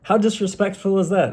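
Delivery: high, outraged question; indignant. Real, not acted. Vlogger telling viewers about girlfriend, indignant. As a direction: sound scandalised.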